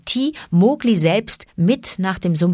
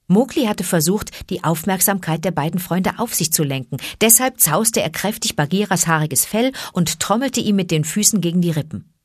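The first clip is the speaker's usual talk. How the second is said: ordinary delivery, nothing marked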